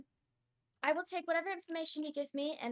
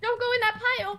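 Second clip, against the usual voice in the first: higher, loud and fast; loud warning; 10 semitones higher, 11 dB louder, faster than usual. Acted. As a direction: blurt out a sharp warning. Character warns, loud and fast.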